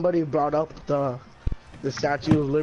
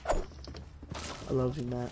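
whisper; 3 semitones lower, 9 dB quieter, much slower than usual. Pretend. Whispered sappy line.

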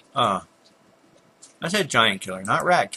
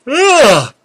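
very high, loud yawn; uncontrollable yawn; 15 dB louder than usual. Real, not acted. Gamer explaining medication gets interrupted by it, yawning loudly. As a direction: let a big yawn burst out. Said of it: "I didn't start yawning until".

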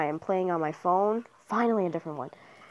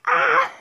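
short, very high loud scream; startled scream; 8 dB louder than usual. Real, not acted. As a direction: scream out in sudden alarm. Startled cry in the game.

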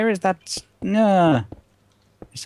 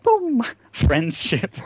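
laughing; slower than usual. Real, not acted. Laughing through jingle.

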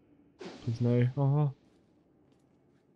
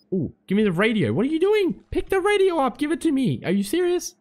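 high-pitched, rushed demands; frantic pleading; 11 semitones higher, faster than usual. Real, not acted. Player begging his teammate for the radio, high frantic voice. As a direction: squeal at your friend to hurry.

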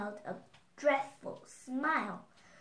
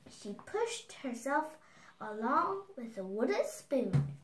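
ordinary delivery, nothing marked

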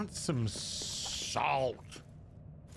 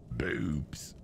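whisper; 12 semitones lower than usual. Acted. Silly whispered aside while gaming.

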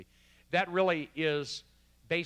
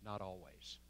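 low, very soft admission; whisper-like voice; 6 semitones lower, 13 dB quieter than usual. Retold or imitated. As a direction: lower the voice almost to a whisper, imitating a humbled answer. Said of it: "very whisper-like voice said".

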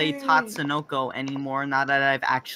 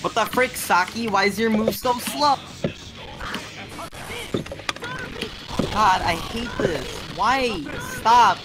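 raging gamer; 6 semitones higher than usual. Real, not acted. Annoyed gamer complaining.

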